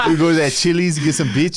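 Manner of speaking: giggling while telling the punchline; laughing through it. Retold or imitated. Retelling the Baldy joke to a podcast friend, laughing. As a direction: laugh it out fast.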